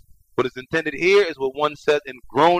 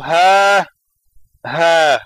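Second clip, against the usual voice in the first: pained groan; 6 dB louder than usual. Retold or imitated. The disbeliever in the grave groans in pain, unable to answer. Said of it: "فَيَقُولُ".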